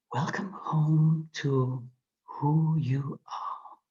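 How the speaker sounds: slow, whispered welcoming voice; a gentle voice greeting the listener home; inner voice. A narrator's reading. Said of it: "you hear this voice speaking to you".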